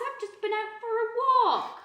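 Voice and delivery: high quick awkward excuse; nervous excuse. Acted. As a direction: squeak out a lame alibi. Lame excuse, high and fast.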